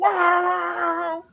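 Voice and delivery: a mock engine whoosh, high; high whooshing car sound; car roar imitation. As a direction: voice the McLaren speeding past, whooshing high. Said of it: "hear that McLaren going".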